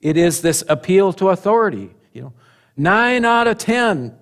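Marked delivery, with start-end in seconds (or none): 2.8-4.2 high, stretched-out announcer pitch; advertising claim. Acted. Preacher mimics a toothpaste advert to the congregation, proclaiming. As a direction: proclaim it like an ad statistic.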